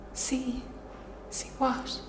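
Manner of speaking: very soft, barely voiced query; small, hushed reply; hushed question. Acting her own dazed question to bystanders.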